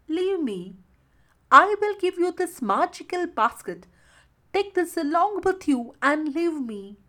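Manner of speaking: high-pitched, helpless bargaining; pleading. A narrator's reading. Helpless mermaid begs the fisherman, pleading.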